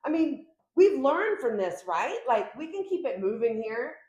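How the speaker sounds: high, loud, offended protest; indignant ex. Retold or imitated. A reader voicing the ex's disbelief, high and indignant. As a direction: protest like a hurt ex who can't believe it. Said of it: "They're like".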